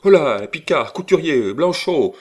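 high, loud summons; calling out. A narrator's reading. Old lady calling valets to block the door, loud summons. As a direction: holler the names to fetch servants at once.

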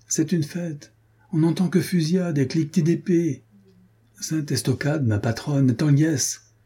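squire's voice. Retold or imitated. [name] imitates the squire relishing the battles, merrily. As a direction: revel in the fighting.

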